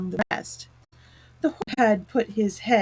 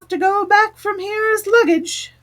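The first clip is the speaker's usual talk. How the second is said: much higher, louder squeaky beetle; beetle's voice; 12 semitones higher, 6 dB louder than usual. A narrator's reading. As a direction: pitch it up high as a tiny beetle. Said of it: "they should all speak in turn".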